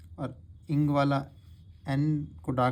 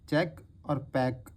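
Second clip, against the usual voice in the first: slow clear demo; slower than usual. Shown as a demonstration. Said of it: "एक quick sound निकल रही है ए".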